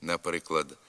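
normal talking voice

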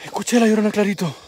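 usual speaking voice

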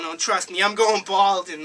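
ordinary talk

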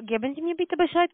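ordinary talk

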